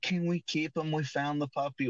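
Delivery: kids' excited plea, lightly mimicked; imitating pleading kids. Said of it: "the kid's like".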